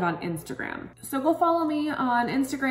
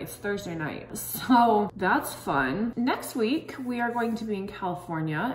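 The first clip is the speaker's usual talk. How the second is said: talking through sheet mask. Real, not acted. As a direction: mouth edges held by mask. Seen 1: sheet mask covers face and mouth edges while speaking; hands press it.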